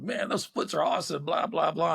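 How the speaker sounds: high-pitched mock gushing; gushing crowd. Bar crowd praising the splits, mock gushing. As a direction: imitate excited fans, voice pitched well up.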